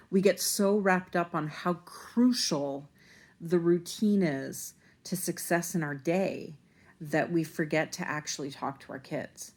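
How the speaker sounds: ordinary delivery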